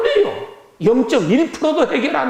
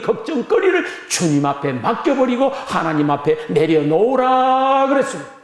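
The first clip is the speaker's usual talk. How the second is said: usual speaking voice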